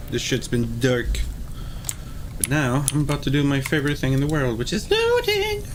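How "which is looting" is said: gleeful squeal on looting; excited. Real, not acted. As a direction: shoot the pitch way up with glee.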